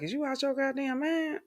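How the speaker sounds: mock disbelief from her friends; incredulous friend. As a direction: play the shocked girlfriend. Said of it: "My girlfriends was looking at me like I was crazy".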